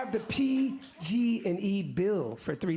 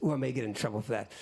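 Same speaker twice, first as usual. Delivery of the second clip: normal talking voice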